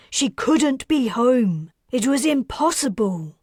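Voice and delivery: ordinary delivery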